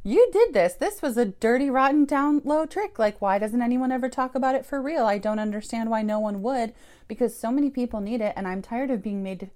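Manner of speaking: high, loud accusing rant, easing off; furious rant at God. Retold or imitated. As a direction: hurl it at God, then run out of steam. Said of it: "screaming at God in my head".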